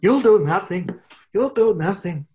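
mock Irish trash talk; McGregor impression. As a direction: threaten like Conor McGregor in a bad Irish accent. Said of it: "That's what he would say to Putin".

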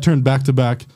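ordinary delivery, nothing marked

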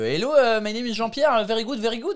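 high-pitched caricature of a Frenchman speaking English; French-accented English. Acted. Comedian mimicking a Frenchman abroad, heavy French accent. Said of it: "quand un Français, euh, typiquement, quand il parle dans des pays anglophones".

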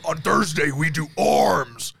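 slow, hammed-up bodybuilder voice; big bodybuilder. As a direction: imitate a bodybuilder's drawn-out TikTok catchphrase. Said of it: "that TikTok of the big bodybuilder".

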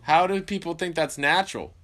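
loud, high disbelief; incredulous outburst. Real, not acted. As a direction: yell in disbelief.

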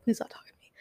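voiceless whisper of "Please stop talking to me". Shown as a demonstration. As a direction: breathe "Please stop" without voice, as inner thought. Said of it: "in my head, I said".

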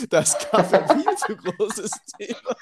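says it through a real laugh, slowly; chuckling while getting the words out. Real, not acted. Laughing at his co-host's coffee remark, words through laughter. Joking about coffee topic.

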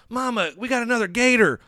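high hillbilly calling to mama; mock Southern accent. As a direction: holler it like a backwoods son. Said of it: "and they're like".

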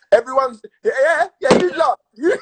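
high voice cracking with laughter; half-crying with laughter; laughing. Real, not acted. Laughing at teasing.

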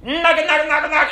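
loud high nonsense holler; imitated sheep call. Random people trying to call sheep, comic high-pitched calling. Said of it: "these people out there going".

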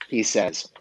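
ordinary delivery

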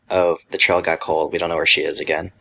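normal talking voice

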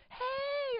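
a sing-song hello to a friend; high excited hey. Retold or imitated. Someone greets excitedly.

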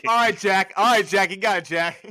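loud, high brush-off voice; mock dismissive. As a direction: wave it off sarcastically. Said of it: "mocking Marky's answer".

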